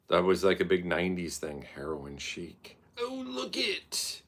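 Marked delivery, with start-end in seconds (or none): 3.0-4.2 high, slow, soft mock awe; mock breathy awe. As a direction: gush over it in a mocking coo. Mocking voice again.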